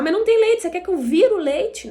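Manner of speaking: high, loud exasperated retort; exasperated parent. Retold or imitated. Pai impaciente com criança chorando. (pai impaciente, imitado em voz alta e aguda)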